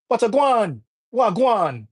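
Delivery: amateur Jamaican accent on the greeting; Jamaican patois. Shown as a demonstration. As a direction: demonstrate a Jamaican-style "wagwan". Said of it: "the way Jamaican people speak".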